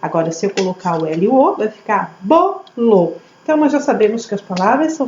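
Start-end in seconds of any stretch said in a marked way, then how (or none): 2.2-3.1 "bolo" split as bo... lo; syllable by syllable. Shown as a demonstration. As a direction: join the syllables of "bolo" in two slow beats. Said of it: "Vai ficar uma sílaba sozinha".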